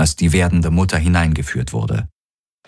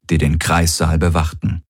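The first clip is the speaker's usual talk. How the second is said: usual speaking voice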